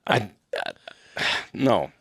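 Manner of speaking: ordinary delivery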